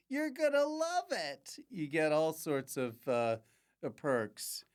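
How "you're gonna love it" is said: singsong sales pitch. Pretend. Host plugging Patreon to listeners, singsong sales pitch. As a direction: sell it in a silly high voice. Playful high hard sell of Patreon.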